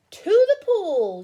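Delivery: loud slow shout, pitched high; yelled. Acted. Fireman yelling where to take the fish, loud slow shout. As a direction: shout it out to the whole crowd. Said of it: "yelled the fireman".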